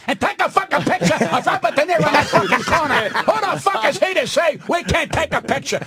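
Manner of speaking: high-pitched, indignant comic tirade; retold stage rant. Comic calling out a star from the stage, indignant rant. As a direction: launch into a mock-outraged rant.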